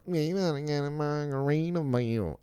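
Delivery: high, airy mock girly voice; mocking girl whining. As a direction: squeal it like the girls begging. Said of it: "they were like".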